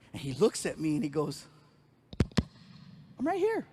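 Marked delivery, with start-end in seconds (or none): 3.2-3.7 put-on kid confused. Kid voiced.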